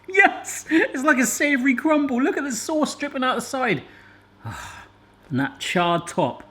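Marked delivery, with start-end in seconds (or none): 0.1-3.8 excited. Real, not acted. Delighted after tasting pie.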